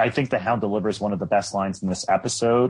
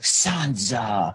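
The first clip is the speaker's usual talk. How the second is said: lightly voiced creepy Baelish saying her name; a hint of Gollum, drawn out and sinister; creepy Gollum vibe. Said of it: "I was getting this Lord of the Rings Gollum vibe".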